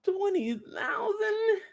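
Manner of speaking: high, slow, cracking gasp of disbelief; can't believe the price; stunned disbelief. Real, not acted. Reacting to Phil's twenty thousand bid, stunned disbelief.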